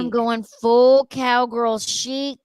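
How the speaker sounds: slow, loud, drawn-out declaration; drawn-out proclamation. Acted. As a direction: proclaim it slowly like a slogan.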